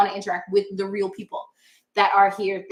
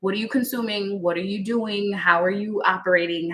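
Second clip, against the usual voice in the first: ordinary talk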